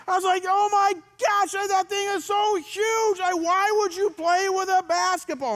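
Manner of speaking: high, loud, slow frantic yell; yelling at the hurt kid in a panic; shouting at the crying boy over his goose egg; retold yelling at son. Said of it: "And I'm yelling at him".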